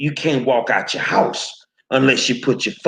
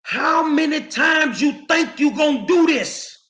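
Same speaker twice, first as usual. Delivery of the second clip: high-pitched, fed-up outburst; exasperated shout; 10 semitones higher than usual. Real, not acted. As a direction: demand an answer, voice jumping up in anger. Fed-up rant at commenters.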